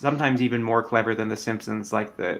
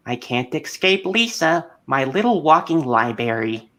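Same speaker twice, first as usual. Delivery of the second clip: Marge impression; 4 semitones higher than usual. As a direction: do a raspy Marge Simpson. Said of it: "Marge is like".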